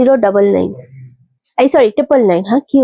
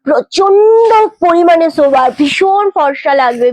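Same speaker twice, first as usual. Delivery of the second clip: emphatic sales pitch; 4 semitones higher, slower than usual. Real, not acted. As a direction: sell it big, stretching every word. Hyping a saree colour.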